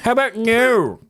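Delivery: smug high villain voice; clipped mocking villain line; Doctor Evil. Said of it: "Okay, that was better".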